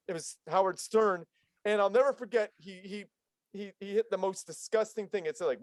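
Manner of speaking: ordinary talk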